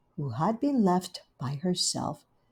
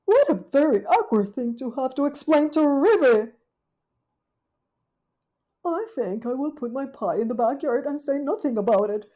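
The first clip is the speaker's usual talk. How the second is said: higher, fretful lady dog; scheming Duchess; 6 semitones higher, 5 dB louder than usual. Acted. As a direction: plot it half to yourself. Duchess plots to hide pie.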